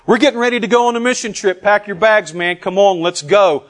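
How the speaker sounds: fast, high, loud urging; rally the team to leave right away; hammed-up eager recruiter; gung-ho rallying. Acted mission trip rally call.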